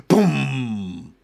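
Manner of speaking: big booming noise falling away; mock boom. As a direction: imitate an explosion, letting it ring out.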